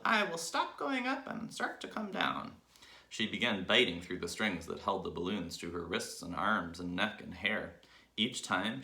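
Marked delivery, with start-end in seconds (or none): 0.1-2.6 put-on very high scheming old woman; old hag voice. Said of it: "she said to herself".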